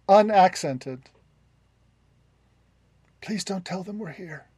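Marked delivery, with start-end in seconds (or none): 3.2-4.5 very soft, secretive plea; hushed plea. Acted. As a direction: beg quietly from a hiding place. Hidden person pleads from behind curtain.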